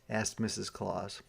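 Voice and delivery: usual speaking voice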